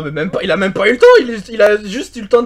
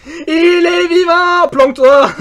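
excited; 9 semitones higher, 6 dB louder, much slower than usual. Real, not acted. Relief teammate survived the hunt.

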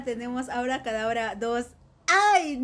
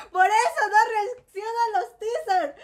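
mock crying; 6 semitones higher than usual. Real, not acted. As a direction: wail it like crying. Repetición llorosa y gritada. (queja llorosa y exagerada)

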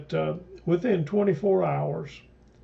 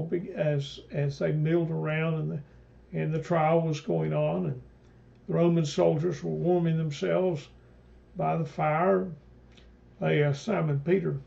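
ordinary talk, nothing marked

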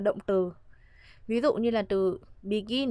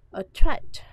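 ATTRACT with TRACT punched much louder; strong second-syllable stress; 3 semitones higher, 11 dB louder than usual. Shown as a demonstration. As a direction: hit the "tract" of "attract" hard. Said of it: "chúng ta đọc rõ trọng âm này".

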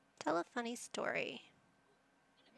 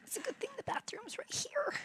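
whispered relief. Acted. Sim voiced in whisper.

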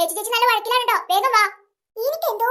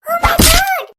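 higher and louder gasp of disbelief; shocked; 5 semitones higher, 6 dB louder, much slower than usual. Acted. Left alone by her friend, shocked cry. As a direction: blurt it out in shock. Shocked after being abandoned.

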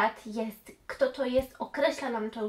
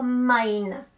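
"mine" stretched slowly and said louder; stress on mine; 7 dB louder than usual. Shown as a demonstration. Teacher correcting students, stressing "mine". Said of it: "to nie powiemy a friend of my".

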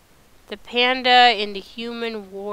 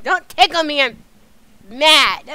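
loud, high irritated outburst; annoyed protest; 5 semitones higher, 8 dB louder than usual. Real, not acted. Player telling the tickler off, annoyed. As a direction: snap it loudly, pitched up.

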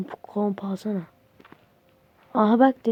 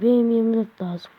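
usual speaking voice

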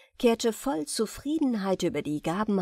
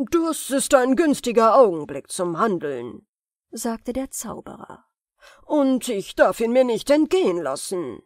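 lauter, entschlossener Zauberer; listiger Zauberer; 2 semitones higher, 6 dB louder than usual. Acted. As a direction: Zauberer freut sich über die Gelegenheit. Zauberer wittert seine Chance. (Zauberer spricht zu sich, entschlossen und lauter)

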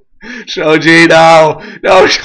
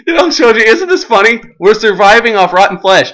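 ordinary delivery

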